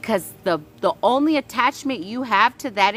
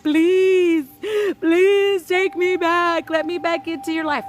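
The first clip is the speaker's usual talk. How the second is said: mock pleading ex; 7 semitones higher, 4 dB louder than usual. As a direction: beg to be taken back, pitched way up. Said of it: "And they're like".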